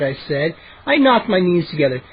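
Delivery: ordinary delivery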